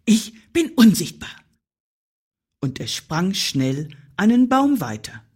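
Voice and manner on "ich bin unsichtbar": raised, urgent animal voice; startled squirrel. Acted. Squirrel hiding behind its paws, startled. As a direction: blurt it higher and louder, startled. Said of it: "flüsterte das erschrocken".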